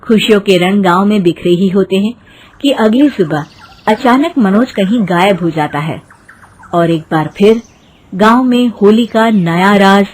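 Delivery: ordinary delivery, nothing marked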